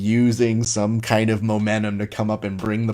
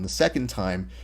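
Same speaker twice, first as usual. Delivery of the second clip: ordinary delivery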